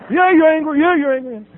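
high, loud mimicked accusation; nosy group piling on. Therapy group jumping in on patient, insistently accusing. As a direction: pounce on it like a nosy chorus. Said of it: "They got nosy is what they did".

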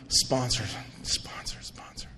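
sponsor, sponsor, sponsor fading from soft to a whisper; dreamy whispered chant. Retold or imitated. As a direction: let each repeat of the word drop away to nothing.

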